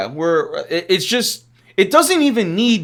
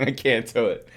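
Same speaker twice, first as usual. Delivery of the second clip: softer, breaking up with laughter; laughing; 4 semitones lower, 6 dB quieter than usual. Real, not acted. Host trying to defend the GM, cracking up laughing. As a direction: try to talk through the giggles. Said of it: "I can't get through it without laughing".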